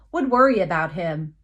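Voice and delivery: usual speaking voice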